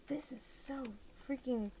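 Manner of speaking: usual speaking voice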